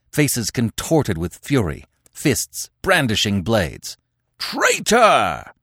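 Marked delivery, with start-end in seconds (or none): 4.4-5.5 high-pitched mob howl; wholehearted roar. Acted. Tribesmen answer Conan from the gorge, roaring. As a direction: hurl it up like a whole angry crowd. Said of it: "they roared back wholeheartedly".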